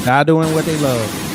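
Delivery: cheering. Real, not acted. Cheering clip people on.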